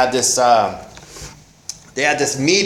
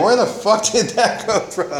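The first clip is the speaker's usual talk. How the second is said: higher, startled disbelief with a laugh; shocked inner thought; 7 semitones higher than usual. Retold or imitated. A man startled by his own thought, incredulous. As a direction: catch yourself off guard and laugh at it. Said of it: "and I was like".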